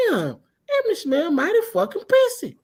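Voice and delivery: high-pitched mock amazement; retold disgusted amazement. Man smells her as she runs inside, amazed. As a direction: play up catching a bad whiff.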